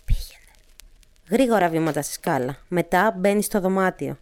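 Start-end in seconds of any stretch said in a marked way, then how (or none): 0.1-0.5 short whispered command; whispering. A narrator's reading. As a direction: whisper the order. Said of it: "ψιθυρίζοντας". (ψιθυριστή εντολή)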